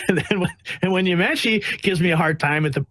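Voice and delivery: ordinary talk, nothing marked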